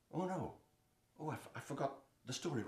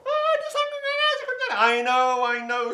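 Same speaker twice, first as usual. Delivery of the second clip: lion cutting in; 14 dB louder than usual. Acted. Lion interrupts the girl.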